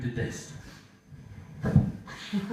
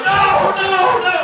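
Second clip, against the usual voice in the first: high, loud panicked wail of no; panicked cry; 8 dB louder, much slower than usual. Acted. Small dog trapped by big dogs, high panicked yelp. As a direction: scream it like a trapped little dog.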